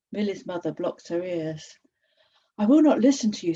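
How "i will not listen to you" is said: a loud, firm refusal; defiant outburst. A narrator's reading. Mother refusing Sad, loud and defiant. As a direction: push back hard against the voice.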